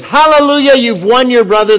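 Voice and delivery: high, loud rejoicing burst; joyful hallelujah. Acted. Preacher voicing Jesus celebrating a won brother, joyful and loud. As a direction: shout it like good news you can't contain.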